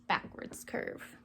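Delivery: "backwards curve" breathed out in a low whisper. Shown as a demonstration. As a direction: whisper "backwards curve" softly while tracing. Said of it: "tracing the stroke aloud".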